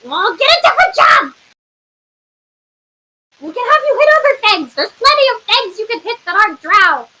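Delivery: high, rapid scolding; exasperated retort. Acted. Council member answers a guard, exasperated scolding. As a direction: argue back in a high, exasperated voice.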